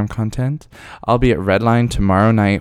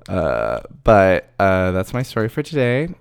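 usual speaking voice

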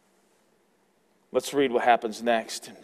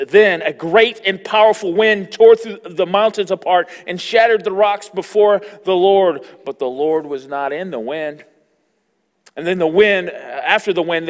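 loud, higher sermon reading of the storm; forceful preaching; 4 semitones higher, 9 dB louder than usual. Acted. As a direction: proclaim the wind and earthquake. Preaching the scripture at full force.

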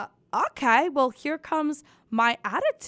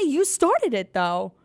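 ordinary delivery

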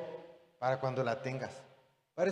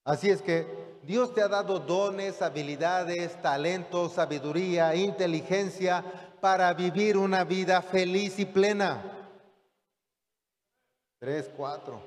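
predicación creciente; 2 semitones higher than usual. Acted. Predica con fuerza creciente.

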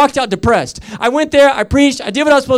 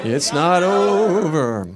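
softer, very slow, stretched "over"; drawn-out declaration; 3 dB quieter, much slower than usual. Real, not acted. Preacher after the congregation repeats it, drawn-out declaration. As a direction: draw the words out long, letting "over" fall.

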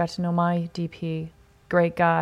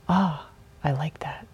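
whispered reply; 3 semitones lower, 4 dB quieter than usual. Retold or imitated. Retells his hushed pleased reply.

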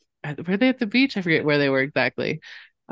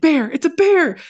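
high, urgent alarmed call; mock alarm; 12 semitones higher, 4 dB louder than usual. Husband spotting the bear, high mock alarm. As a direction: sound the alarm, half-joking. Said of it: "And Wes was like".

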